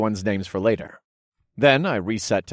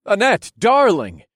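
loud call; 12 semitones higher, 7 dB louder than usual. Acted. Calling her warmly.

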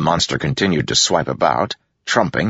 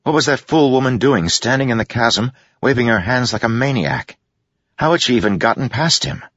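higher-pitched, exasperated disbelief; incredulous alarm; 7 semitones higher than usual. A narrator's reading. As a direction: ask it in rising disbelief at her recklessness. Hero alarmed at woman's reckless act.

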